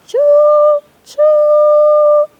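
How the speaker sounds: high loud choo choo whistle; train whistle. A narrator's reading.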